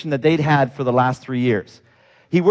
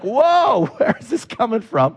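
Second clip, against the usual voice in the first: higher, laughing astonishment; amazed laugh; 5 semitones higher than usual. Retold or imitated. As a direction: gasp in delighted disbelief. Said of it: "I'm like".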